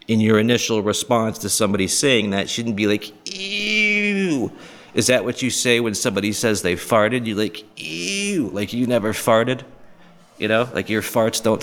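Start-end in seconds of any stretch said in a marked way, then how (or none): 3.3-4.5 long, high-pitched ew; mock disgust. Mimics disgusted response to confession. 7.8-8.5 high, softer drawn-out ew; mock disgust. Mimics disgust at a fart.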